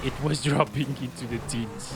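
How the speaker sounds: normal talking voice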